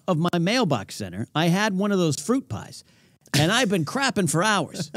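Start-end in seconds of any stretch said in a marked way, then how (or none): none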